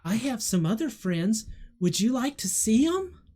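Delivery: put-on high, lilting child's invitation; high-pitched little girl, rising at the end. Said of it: "turns with you in her hand and says".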